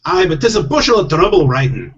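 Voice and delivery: high, loud protesting Scottish pixie; hammed-up Scottish husband, reluctant; Scottish accent. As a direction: complain in a put-on Scottish accent. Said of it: "I do have to do Scottish accents".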